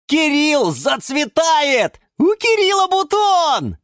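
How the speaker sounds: very high, loud, drawn-out cry; excited shouting. Acted. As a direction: shout the news out to everyone. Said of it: "закричали все в один голос". (восторженный крик)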